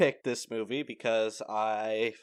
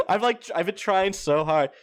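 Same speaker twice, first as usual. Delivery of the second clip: ordinary talk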